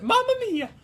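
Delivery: high cartoon Italian plumber, easing downward; high sing-song game mascot catchphrase; Mario. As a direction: start squeaky and slide down in an Italian lilt. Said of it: "When you're trying to find the accent".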